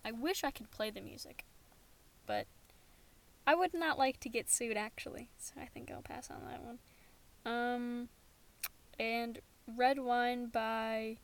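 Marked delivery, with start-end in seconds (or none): 5.4-6.8 soft whispered aside; whispering. Real, not acted.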